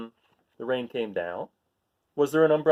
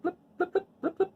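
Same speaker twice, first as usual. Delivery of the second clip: pond blips; 3 dB quieter than usual. A narrator's reading. Raindrops on pond.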